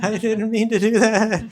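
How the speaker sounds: high, loud mock sobbing plea; wailing apology. Mother-in-law excuses herself to the snake, hammed-up wail.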